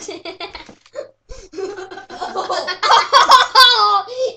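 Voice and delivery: loud high-pitched burst of laughter; big laugh. Real, not acted. Cracks up at own impression.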